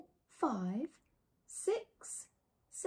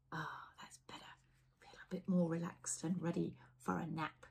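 relaxed soft whisper; 4 semitones lower, 5 dB quieter than usual. Real, not acted. The reader calming the children for a nap. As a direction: settle them down, whispering.

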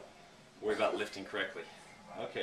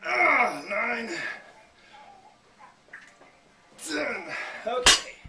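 straining effort; 13 semitones higher, 7 dB louder, much slower than usual. Real, not acted. Straining through final reps.

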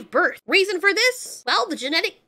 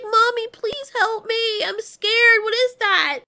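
put-on frightened baby voice; 7 semitones higher, 3 dB louder, slower than usual. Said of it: "and he's like".

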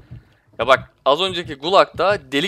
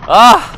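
short loud cry of frustration; a sharp yelp as he dies; frustrated yell; 7 semitones higher, 10 dB louder than usual. Real, not acted. Gets killed. (kısa, öfkeli bir bağırış)

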